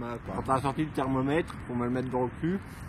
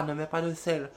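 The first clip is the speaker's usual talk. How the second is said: ordinary talk, nothing marked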